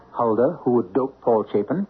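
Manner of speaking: usual speaking voice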